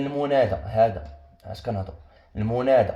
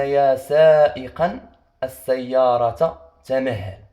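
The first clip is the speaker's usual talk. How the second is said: يا سائقا drawn out slowly and loudly, rising on سائقا; slow emphatic reading; 7 dB louder, much slower than usual. Shown as a demonstration. Teacher reads the second example slowly and loudly to the class. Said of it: "عندنا".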